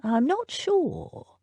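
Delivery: put-on a touch of a puzzled old butler; slow unsure butler. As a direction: let the doubt hang, slowly. Butler admits doubt.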